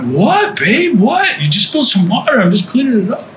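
high, puzzled what-babe; bewildered husband asking what's wrong; incredulous husband. Retold or imitated. Husband baffled by his crying wife, high and puzzled. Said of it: "And I'm like".

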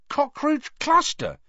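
high, slow, incredulous echo; can't believe that worked; amazed. A narrator's reading. Harry amazed the gargoyle moved, surprised. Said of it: "he said, amazed".

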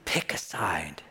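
intense whisper, low; whispered challenge. Pretend. God whispering to a heart, an intense challenge. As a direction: urge it softly but firm.

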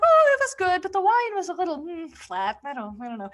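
high, precious restaurant customer, trailing off at the end; prissy diner voice. Retold or imitated. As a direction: play a fussy diner nervously judging the wine.

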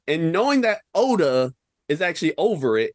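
normal talking voice

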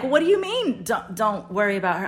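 high, loud incredulous echo; confused challenge. Retold or imitated. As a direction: throw his words back in disbelief. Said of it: "I'm confused".

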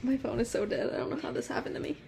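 whisper. Real, not acted. Whispered complaint about dead phone.

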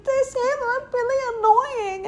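very high squeaky weepy imitation; exaggerated tearful baby voice; mock crying. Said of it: "and she's like".

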